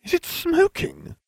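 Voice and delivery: put-on high, slow alarmed girl; a startled schoolgirl exclaiming; Hermione exclaims. A narrator's reading. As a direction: cry out in alarm. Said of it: "the girl exclaimed".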